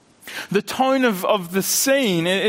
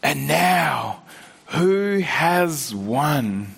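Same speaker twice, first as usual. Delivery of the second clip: quivering voice; 5 semitones lower, much slower than usual. A narrator's reading. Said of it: "said in a quivering voice".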